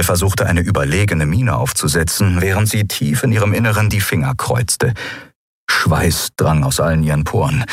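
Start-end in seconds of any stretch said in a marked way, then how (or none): none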